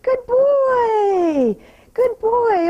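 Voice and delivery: high, loud praise for the horse. Real, not acted. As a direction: cheer him on, bright and loud.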